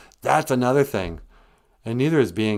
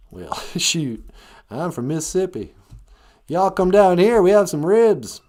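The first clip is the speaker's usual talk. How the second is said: put-on southern accent; 9 semitones higher, 6 dB louder than usual. Mississippian inviting y'all down for ribs, southern accent. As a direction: holler it in a hammed-up southern accent. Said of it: "Maybe I can only do one southern accent".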